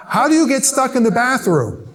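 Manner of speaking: loud, baffled questioning; exasperated disbelief. Acted. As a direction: demand an explanation, incredulous. Incredulous rant at the stuck man.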